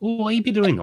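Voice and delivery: rolling West Country voice, like Long John Silver; West Country accent. Shown as a demonstration. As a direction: drop into a thick West Country pirate accent. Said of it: "go into my natural accent".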